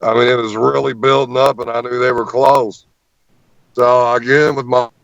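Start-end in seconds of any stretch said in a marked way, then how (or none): none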